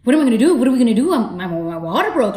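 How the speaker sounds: high, loud panicking questions; panicked cry. Retold or imitated. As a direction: blurt it out in a rising panic. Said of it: "I started panicking".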